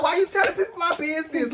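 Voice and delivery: high, loud irritated question; loud, high annoyed complaint to the boyfriend; heated. Retold or imitated. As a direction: snap at him for spreading your news. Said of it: "I just got heated".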